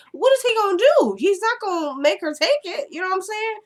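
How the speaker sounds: high-pitched what-is-he-gonna-do disbelief; incredulous. Real, not acted. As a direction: squeak it out as if it's absurd. Incredulous question about Lucien.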